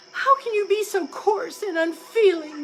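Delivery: high, loud wounded outburst; indignant wife crow; bursting out. A narrator's reading. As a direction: burst out at the husband. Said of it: "Missus Crow burst out".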